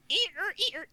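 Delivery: mechanical eer eer creak; creaking spool noise. Retold or imitated. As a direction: imitate a straining spool. Imitating a straining safety spool.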